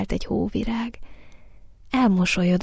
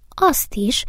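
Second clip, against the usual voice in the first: higher, firmer little flower voice; increasingly firm; 4 semitones higher than usual. A narrator's reading. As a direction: answer more decidedly. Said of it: "szól egyre határozottabban a hóvirág". (egyre határozottabb hangon)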